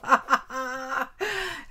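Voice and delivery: real burst of laughter; hearty laugh. Real, not acted. Amused at having startled a viewer.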